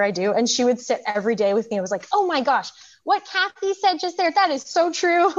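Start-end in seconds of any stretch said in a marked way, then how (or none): 2.1-5.3 higher, lightly voiced little girl gushing; daughter's excited voice. Mother imitating her nine-year-old daughter, a light excited child voice. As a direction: imitate your daughter's delight, laughing at the end.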